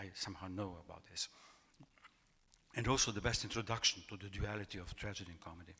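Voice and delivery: normal talking voice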